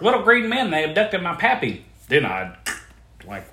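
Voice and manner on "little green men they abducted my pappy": high, loud mock yokel blurting it out; excited believer. Loan applicant loudly insisting aliens took his pappy. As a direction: ham up a wide-eyed abductee. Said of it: "If they're like".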